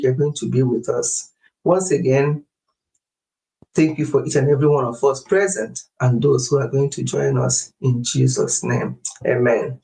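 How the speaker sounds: Sunday live-stream prayer, reverent and steady; closing prayer. Acted. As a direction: pray over the congregation, calm and devout.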